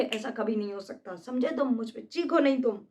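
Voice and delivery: usual speaking voice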